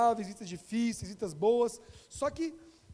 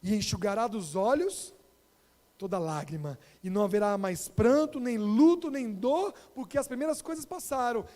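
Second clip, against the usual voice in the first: louder, emphatic scripture reading; Bible reading; 6 dB louder than usual. Real, not acted. Preacher reading Revelation 21 to the children, emphatic. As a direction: proclaim the verse with rising force. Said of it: "que fala o quê?".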